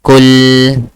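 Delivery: COOL as short u, long held l; correct pronunciation. Shown as a demonstration. Teacher modelling for student, correct pronunciation. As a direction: hold the L in COOL after a short vowel. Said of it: "How do you say?".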